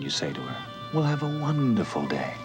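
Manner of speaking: usual speaking voice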